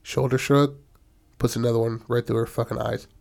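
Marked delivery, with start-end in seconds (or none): none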